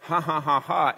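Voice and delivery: hearty mock laugh, lifted and full; imitated laugh of wonder. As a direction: act out Sarah's amazed laugh. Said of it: "It's the laugh of wonder".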